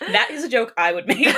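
loud laughter through the words; laughing. Real, not acted. Listener reacting to the reader's joke, laughing loudly. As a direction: talk while cracking up.

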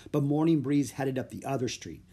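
usual speaking voice

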